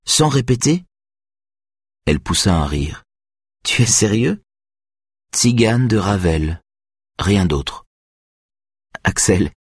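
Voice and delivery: a hint of a nervous girl doubting herself; young violinist Greta, unsure. A narrator's reading. Greta voiced by narrator.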